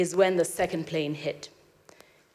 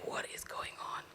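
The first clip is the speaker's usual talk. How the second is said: hushed, breathless whisper; whispered question; 19 dB quieter, faster than usual. Retold or imitated. Crowd in the dark, whispering in fear. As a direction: whisper in frightened awe. Ground shakes, darkness falls.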